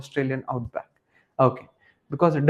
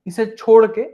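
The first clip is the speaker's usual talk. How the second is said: emphatic insistence; 3 semitones higher, 6 dB louder than usual. Real, not acted. Teacher stressing what to skip.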